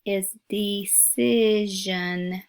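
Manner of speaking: decision stretched slowly to show the zhun sound; slow model word. Shown as a demonstration. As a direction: draw out decision so the zhun is heard. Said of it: "uses that same sound".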